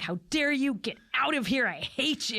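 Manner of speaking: indignant, half-serious movie quote; mock outrage. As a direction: act out a mild retold fit of anger. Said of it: "He gets so mad".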